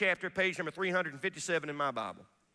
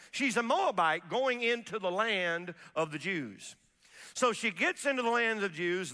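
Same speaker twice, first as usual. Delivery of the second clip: ordinary talk, nothing marked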